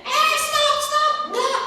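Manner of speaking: a parent yelling to break up a fight; scolding shout. Retold or imitated. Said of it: "Mama saya nggak bilang gini". (bentakan ibu yang dibuat-buat)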